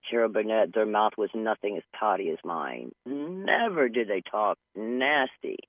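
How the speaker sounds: normal talking voice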